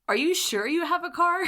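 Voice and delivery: mock the lost-car panic with a high, loud quip; teasing guy. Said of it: "he says to me".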